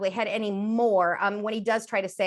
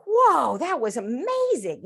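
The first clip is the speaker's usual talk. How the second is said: high, louder gasp of wonder easing off; amazed; 5 semitones higher, 4 dB louder, slower than usual. Retold or imitated. Parent hearing first phrase, amazed. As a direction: play a parent stunned by the child. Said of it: "And you're like".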